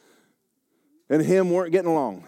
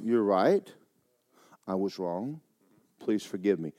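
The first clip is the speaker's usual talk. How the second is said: soft, lower, pleading apology; meek, softer plea; humble apology; 8 semitones lower, 7 dB quieter than usual. Shown as a demonstration. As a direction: ask forgiveness quietly.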